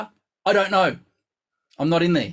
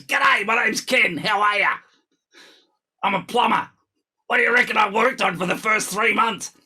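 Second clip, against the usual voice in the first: imitating a student; 5 semitones higher than usual. Teacher mimicking his plumber student Ken, hammed-up bloke. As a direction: ham up the brash plumber. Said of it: "he turns up and goes".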